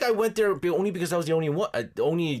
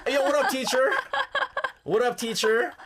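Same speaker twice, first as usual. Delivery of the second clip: higher, laughing street greeting; hey yo like a cocky eight-year-old; New Yorker kid; 10 semitones higher than usual. Retold or imitated. Dad imitating his son greeting the teacher, laughing. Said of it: "be like".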